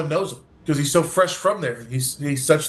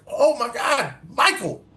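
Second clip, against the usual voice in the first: high, delighted cry of recognition; suddenly recognize an old friend; surprised joy; 7 semitones higher, slower than usual. Retold or imitated. Recognizing the clean man suddenly.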